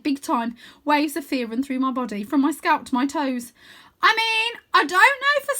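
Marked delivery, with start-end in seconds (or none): 4.0-5.5 calling down in a big high cartoon voice; bright playful wizard-boy voice; Wyn's voice. A narrator's reading. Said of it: "he continues".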